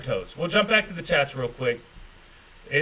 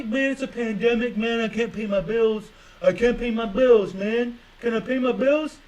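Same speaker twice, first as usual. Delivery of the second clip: mock whiny excuse-maker; 5 semitones higher than usual. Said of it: "hyperbolic example of what".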